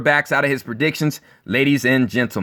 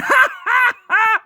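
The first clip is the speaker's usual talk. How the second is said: high shrieking laugh. Real, not acted. Laughing at the Brady bandwagon fans, high shrieking laugh. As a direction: let out a shrill mocking laugh. Mocking fans' shock.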